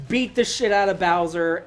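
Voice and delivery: usual speaking voice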